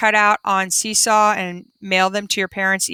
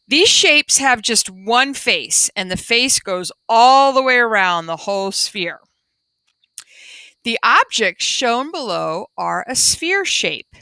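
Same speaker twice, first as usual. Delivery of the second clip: put-on reading to children. Reading the class book aloud.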